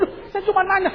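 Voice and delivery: high, meek wifely protest; imitated hurt wife. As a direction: plead innocence in a small high voice. Wife protests in acted quarrel.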